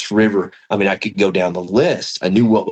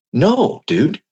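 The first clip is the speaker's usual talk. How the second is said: emphatic no; 8 semitones higher than usual. Retold or imitated. Eager reassurance to Dan.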